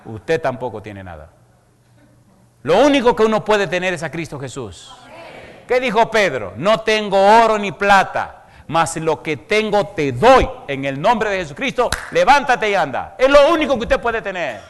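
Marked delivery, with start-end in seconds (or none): 2.6-14.6 full-force preaching. Acted. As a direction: thunder it from the pulpit. Predicación a plena fuerza. (predicación a toda voz, alta y lenta)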